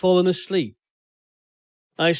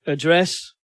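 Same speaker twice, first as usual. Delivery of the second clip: usual speaking voice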